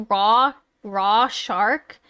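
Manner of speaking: RAW SHARK sounded out very slowly as two plain English words; puzzled mispronunciation. Acted. Baffled character on a call repeating a name, puzzled slow RAW SHARK. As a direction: draw out RAW, then say SHARK like the fish. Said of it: "someone's getting a call and they're like".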